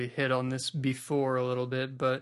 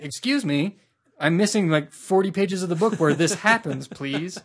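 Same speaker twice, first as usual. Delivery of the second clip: indignant complaint; 4 semitones higher, 6 dB louder than usual. Retold or imitated. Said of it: "I'm like".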